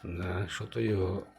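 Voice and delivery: ordinary delivery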